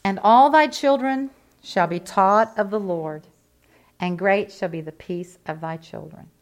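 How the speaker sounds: slow, solemn scripture reading; measured reading of a Bible verse. Acted. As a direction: read it out like holy writ. Reads scripture aloud.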